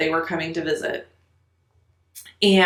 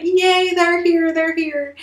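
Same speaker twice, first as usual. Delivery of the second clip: much higher, gleeful cheering that trails off; excited screaming; 11 semitones higher, slower than usual. Retold or imitated. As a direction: cheer like kids running out to the car. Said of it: "start screaming and shouting".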